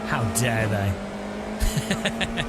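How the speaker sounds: drawn out, slow and indignant; very slow theatrical how dare they; mock outrage. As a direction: ham up being deeply insulted. Mock offence at Broadbent comparison.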